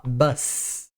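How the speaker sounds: bus, ending in a hissed unvoiced S; unvoiced final s. Shown as a demonstration. Teacher showing students the singular bus, crisp S sound. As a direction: say bus with a sharp voiceless S at the end. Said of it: "Quando você fala ônibus no singular".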